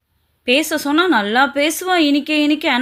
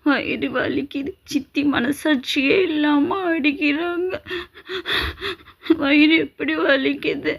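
softer, slow and tearful; quiet sobbing; 4 dB quieter, slower than usual. Acted. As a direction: whimper it to yourself. Girl sobbing alone after the beating.

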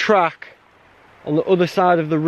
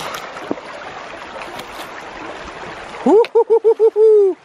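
long high breathy gasp from icy water; gasp at cold water. Real, not acted. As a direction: suck in air at the freezing river. Stepping into cold river.

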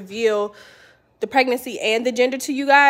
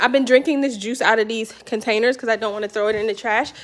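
normal talking voice

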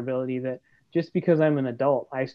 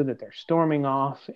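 usual speaking voice

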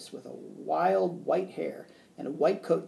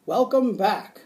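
raised, loud welcome; hearty greeting; 6 semitones higher, 9 dB louder, slower than usual. A narrator's reading. Mad scientist welcoming the teacher back, loud and hearty. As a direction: call it out high and strong.

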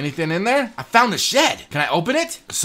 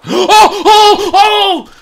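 loud high gasping shriek; shrieking laughing panic; startled scream; 13 semitones higher, 10 dB louder, much slower than usual. Real, not acted. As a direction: jolt in real fright. Jump scare screams.

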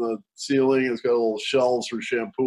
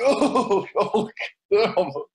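much higher, pained oh no; wincing dismay; 7 semitones higher, much slower than usual. Retold or imitated. Narrator hearing about the eye, wincing high. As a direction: wince as if feeling his pain. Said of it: "I was like".

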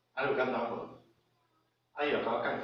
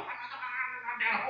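high, rapid imitated hollering; high-pitched babbled mock shouting; mock yelling noise; 9 semitones higher, faster than usual. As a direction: imitate a wife's wordless tirade. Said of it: "大声就叫啊".

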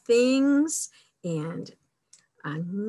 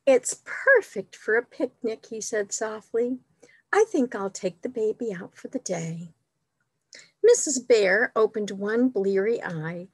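animated picture-book narration, a bit louder than chat; storytime reading; 4 dB louder than usual. A narrator's reading. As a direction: read it out expressively for little listeners. Reads picture book to children.

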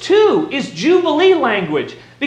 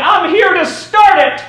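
loud, raised preacher's shout; bold proclamation; 5 semitones higher, 5 dB louder than usual. Acted. Preacher voicing Jesus announcing Jubilee in the synagogue, bold shout. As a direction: declare it boldly, punching "start".

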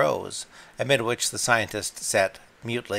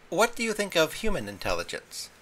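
higher, timid lady's voice; a hint of Mavis asking the cat lamely, higher; lame question; 8 semitones higher than usual. A narrator's reading. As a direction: ask it lamely, unsure. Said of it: "asked Mavis Pellington lamely".

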